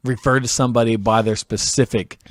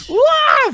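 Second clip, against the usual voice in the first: shrill party whoop; high whoop; 5 dB louder than usual. Retold or imitated. Queen at the concert letting out a high whoop. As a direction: whoop like a raver.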